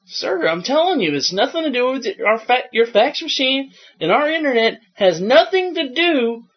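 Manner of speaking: exasperated support tech. Retold or imitated. Acted argument with caller.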